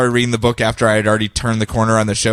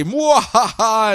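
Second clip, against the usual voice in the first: high, slow villain laugh; slow evil cackle; 9 semitones higher, much slower than usual. Retold or imitated. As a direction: gloat with a drawn-out cackle. Villain gloating.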